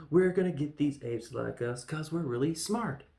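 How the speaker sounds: lightly mocking the hero's boast; mock dim voice. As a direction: play him as a smug simpleton. Voicing Taylor's note mockingly.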